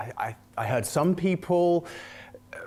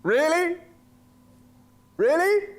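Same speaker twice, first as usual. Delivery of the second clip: high, louder disbelieving really; incredulous; 4 dB louder than usual. Real, not acted. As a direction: squeak it out in disbelief. Disbelief at his accent.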